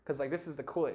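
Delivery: ordinary talk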